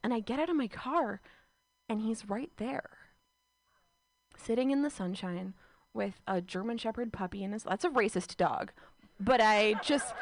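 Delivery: ordinary delivery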